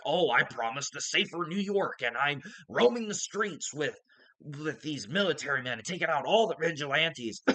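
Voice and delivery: strained, put-on mock villain voice; mock a pompous crime boss in a forced, uneven voice; mocking Fisk impression. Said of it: "I can't talk like that".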